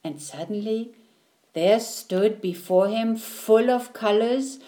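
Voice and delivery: ordinary delivery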